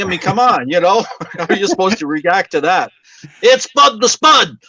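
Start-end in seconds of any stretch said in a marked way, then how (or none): 3.4-4.6 high, loud and slowed, the line hammered home. Retold or imitated. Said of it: "he just clobbers you with it".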